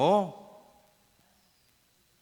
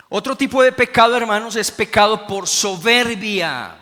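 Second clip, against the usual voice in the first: raised preaching voice; 6 semitones higher, 8 dB louder than usual. Real, not acted. Predicador sube la voz.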